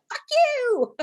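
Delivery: high, squeaky, dismissive mock retort; mock defiant retort. Imitating the prideful listener slamming the door, high mock retort. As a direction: fling it off like a petty, proud ex. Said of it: "You're like".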